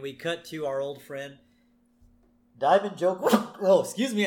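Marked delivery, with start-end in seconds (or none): 2.6-4.3 sneeze interrupting. Real, not acted. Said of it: "I just had to sneeze there".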